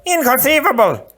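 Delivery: very high, loud scornful voice; Sicilian's cry. Acted. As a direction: spit it out in disbelief. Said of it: "the Sicilian cried".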